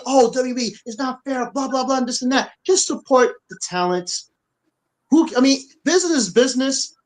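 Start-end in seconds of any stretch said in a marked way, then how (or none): 0.1-2.5 whiny mocking fan voice, a bit higher; mock whining fan. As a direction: parody the complaining fans, "blah, blah" rushed. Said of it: "Don't be crying and saying".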